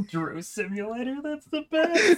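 cracking up, voice jumping up high; laughing hard. Real, not acted. Podcaster cracking up at a joke game name, laughing hard. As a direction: say it helplessly through laughter.

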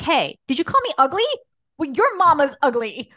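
put-on loud, high taunt at a kid; mocking comeback to a schoolkid; mock confrontation. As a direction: pretend to confront the bully. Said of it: "be like".

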